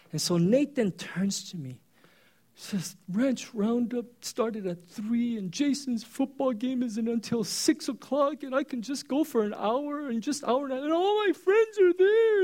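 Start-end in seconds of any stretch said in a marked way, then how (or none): 3.1-12.4 put-on high, rising kid's plea climbing to a wail; whiny pleading kid. Son begging his dad to go to Ranch Roundup, high tearful plea. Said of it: "And he's just doing this".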